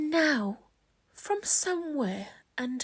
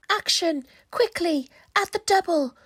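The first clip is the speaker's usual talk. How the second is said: urgent rallying call; 7 semitones higher, 6 dB louder than usual. Acted. Creatures rally to rescue.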